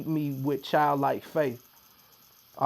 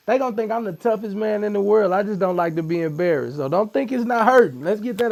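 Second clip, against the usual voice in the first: louder, straining through pain; strained loud; 6 semitones higher, 8 dB louder than usual. Real, not acted. Guest admits the tattoo hurts, louder and strained. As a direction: push the words out over the sting.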